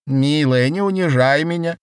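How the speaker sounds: high, loud, aggrieved complaint; high vibrating voice. A narrator's reading. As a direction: protest in a quavering high pitch. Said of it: "высоким вибрирующим голосом".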